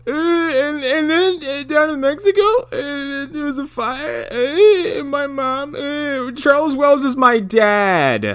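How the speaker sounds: very high, whiny mock sobbing; hammed-up fake cry, squeaked through to the end; Daniel Baldwin badly fake-crying. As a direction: blubber as if about to laugh. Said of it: "sounded like he was getting ready to laugh".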